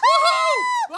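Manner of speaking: very high, drawn-out whoa; high-pitched squeal of a whoa; thrilled whoop. Acted. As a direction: whoop as if on a wild ride. Thrilled on a slide.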